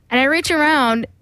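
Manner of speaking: ordinary talk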